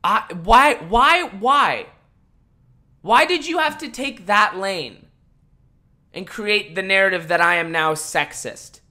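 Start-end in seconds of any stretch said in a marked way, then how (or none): 0.0-5.1 exasperated why. Real, not acted. Exasperated questioning.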